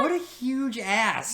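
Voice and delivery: high, drawn-out disbelief; indignant exclamation. Retold or imitated. Storyteller reliving disgust at a laughing boyfriend, indignant exclamation. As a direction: stretch the insult out slowly, appalled. Retells own outraged reaction.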